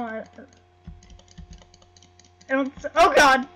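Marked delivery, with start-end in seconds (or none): 2.5-3.5 startled yell. Real, not acted. Attacked in the game.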